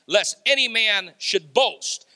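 ordinary talk, nothing marked

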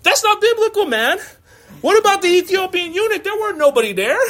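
hammed-up outrage; mock indignant protest. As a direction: protest like a man who has caught a loophole. Pastor argues with wife.

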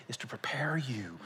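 sudden hushed aside; urgent whisper. Real, not acted. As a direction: drop to an intense whisper. Preacher drops voice.

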